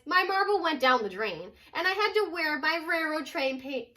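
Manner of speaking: plain reading voice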